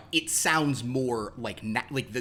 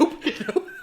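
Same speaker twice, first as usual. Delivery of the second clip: high, laughing nope nope; tossed-off high refusal; mock quick refusal; 8 dB louder than usual. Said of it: "Herman's like".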